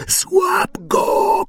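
very high screaming command; shrieking order. A narrator's reading. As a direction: scream it at Quirrell. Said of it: "krzyknął Voldemort". (przenikliwy, wysoki wrzask)

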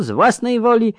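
high sneering echo; mocking imitation. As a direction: parrot his words back, mockingly. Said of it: "jak samiście słyszeli".

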